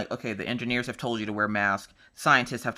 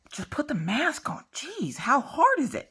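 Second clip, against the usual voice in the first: exasperated; 13 semitones higher, slower than usual. Real, not acted. Exasperated rant about masks.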